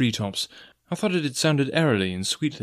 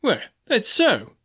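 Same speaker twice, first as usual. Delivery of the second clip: relieved; 11 semitones higher, slower than usual. Acted. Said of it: "greatly relieved".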